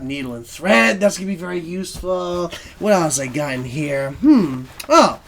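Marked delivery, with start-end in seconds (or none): none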